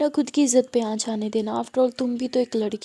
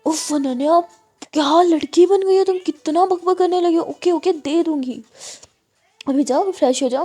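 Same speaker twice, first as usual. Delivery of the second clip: loud, higher fed-up surrender; mother giving in loudly to her nagging daughter; exasperated giving in; 5 semitones higher, 5 dB louder than usual. Acted. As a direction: give up with a huff.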